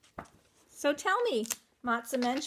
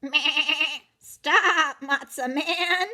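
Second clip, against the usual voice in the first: high, loud goat bleat on the words; 4 semitones higher, 7 dB louder, much slower than usual. Acted. Goat calling after the matzo man, bleating loudly. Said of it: "bleated the goat".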